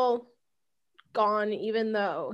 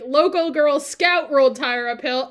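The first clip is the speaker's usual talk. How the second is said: indignant correction; 4 semitones higher, 6 dB louder than usual. Retold or imitated. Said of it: "and I was like".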